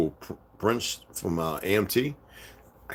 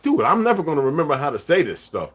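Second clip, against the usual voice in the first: raised, animated exasperation; retold protest; 8 semitones higher, 6 dB louder than usual. Telling his friend he'll never remember, loud animated protest. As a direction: protest loudly at a higher pitch.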